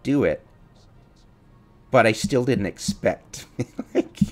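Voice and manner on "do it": cocky. Retold or imitated. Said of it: "If I'm cocky and said".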